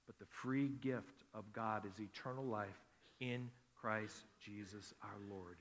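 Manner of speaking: usual speaking voice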